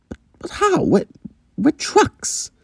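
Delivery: very high, stammering bewilderment; acted, halting high-pitched protest; flustered disbelief. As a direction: sputter it in a high character voice. Octavia doubts they can escape.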